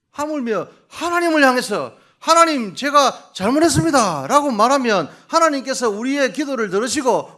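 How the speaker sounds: loud, high fervent proclamation; preaching at full force. Acted. Preacher to the congregation, a loud fervent cry. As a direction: lift it to a shouting climax.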